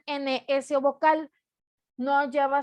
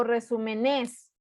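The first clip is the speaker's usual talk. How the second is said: "resúmenes" with the stress moved onto the last syllable, NES; wrong final stress; slower than usual. Shown as a demonstration. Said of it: "ni digo resúmenes".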